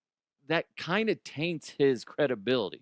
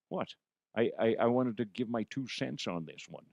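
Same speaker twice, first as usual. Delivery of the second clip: a hint of a second character, Connery, chiming in softly; 2 semitones lower, 5 dB quieter than usual. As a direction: play Connery butting in to give his two cents. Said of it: "Con-, Connery".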